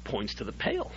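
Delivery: ordinary talk, nothing marked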